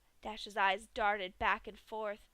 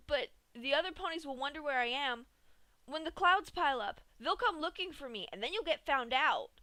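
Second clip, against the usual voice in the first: raised, fast desperate plea; 5 semitones higher, 5 dB louder than usual. A narrator's reading. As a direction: blurt it out in desperation. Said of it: "Dash cried out in desperation".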